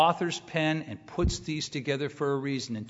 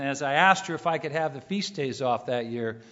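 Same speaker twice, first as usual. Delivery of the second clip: usual speaking voice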